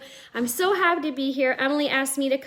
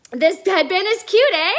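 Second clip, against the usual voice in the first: high, louder, seeking agreement; playful eh demo; 6 semitones higher, 7 dB louder than usual. Shown as a demonstration. Canadian showing viewers how eh works, playful and high. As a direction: call it out brightly with a tag "eh?".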